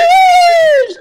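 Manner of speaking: sudden high yelp of surprise; excited yell. Real, not acted. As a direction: blurt it out in shock. Excited shout at finding flopper.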